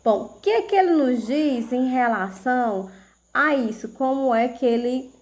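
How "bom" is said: ordinary talk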